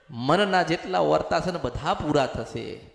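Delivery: ordinary talk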